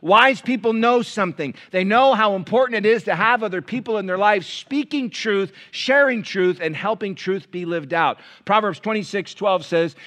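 loud, high preaching from the pulpit; thundering sermon voice; full-force preaching. Acted. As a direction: drive it home to the congregation.

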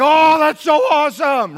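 high, slow, loud gushing; swooning listener. Retold or imitated. Congregant gushing over the Scottish pastor's voice. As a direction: gush like a starstruck fan. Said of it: "And he's like".

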